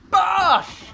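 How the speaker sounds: loud, high shout of impact; exclaimed shot call. Real, not acted. Commentator calling his own padel shot, exclaimed. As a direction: punch it out as one big burst.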